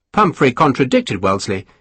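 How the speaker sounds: ordinary delivery, nothing marked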